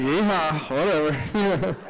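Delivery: low, slow, unenthusiastic yeehaw; mock a half-hearted yeehaw; bored yeehaw. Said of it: "some of you are like".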